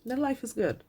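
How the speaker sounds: real near-tears; holding back tears. Real, not acted. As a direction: voice high and catching, fighting tears. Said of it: "And I'm trying not to cry right now".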